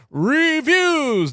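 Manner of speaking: high, loud announcer call; megaphone voice. Shown as a demonstration. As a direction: shout it like through a megaphone. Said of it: "Do it with the, uh, megaphone voice".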